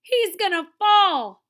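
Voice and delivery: high, loud, slow warning; drawn-out yell of alarm; alarmed shout. Acted. Wahoo warns as the man slips, alarmed shout.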